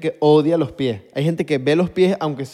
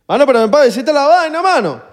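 louder, higher, fast call to come back; calling out to friend; 9 semitones higher, 6 dB louder than usual. Real, not acted. Calling his wandering friend back to sit, louder and higher. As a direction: call him back across the room, quickly.

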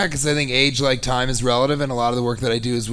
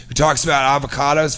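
ordinary talk, nothing marked

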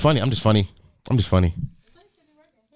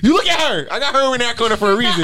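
high, giggling through the words; laughing hard; 11 semitones higher, 3 dB louder than usual. Real, not acted. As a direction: talk while cracking up. Cracking up at friend.

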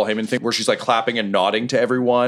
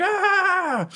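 crowd roar. Acted. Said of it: "And everyone's like".

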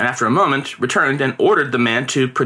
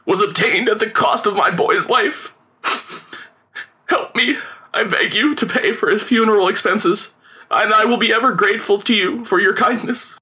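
high, tearful supplication; theatrical mournful plea; grief-stricken begging; 8 semitones higher than usual. A narrator's reading. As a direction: beg the gentlemen as a bereaved father, pitched up. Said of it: "he said, falling to his knees".

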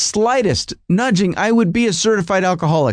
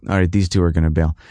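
maitre d' voice; 12 semitones lower than usual. Retold or imitated. Maitre d' guy gets the hint.

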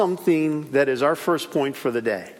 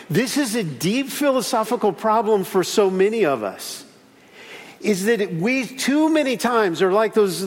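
ordinary delivery

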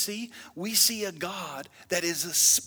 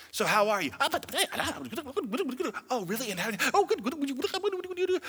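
quick mock small talk; lightly voiced chatty people; 6 semitones higher, faster than usual. Preacher mimicking people he got talking about themselves, chatty. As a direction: babble like an eager talker.

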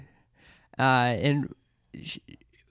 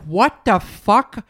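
stunned disbelief; 4 semitones higher, 6 dB louder, much slower than usual. Retold or imitated. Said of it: "I'm like".

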